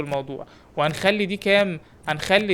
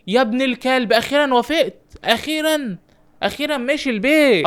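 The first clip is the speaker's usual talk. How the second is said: excited relief; 6 semitones higher than usual. Real, not acted. Gamer cheering the stubborn player's exit, elated. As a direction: burst out like you finally won. Celebrating player finally leaving.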